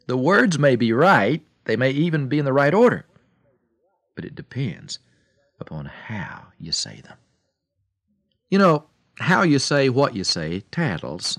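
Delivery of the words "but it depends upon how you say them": soft, hushed closing of the point; whisper. Real, not acted. Preacher to radio listeners, whispered. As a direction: breathe it, almost secret. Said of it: "preacher drops to whisper".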